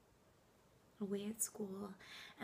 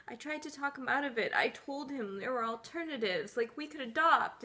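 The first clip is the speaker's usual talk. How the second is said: louder and higher, building in force; agitated pleading; 3 semitones higher, 10 dB louder than usual. Acted. Wife recounts fighting her husband's divorce, agitated and loud. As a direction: push it out with rising desperation.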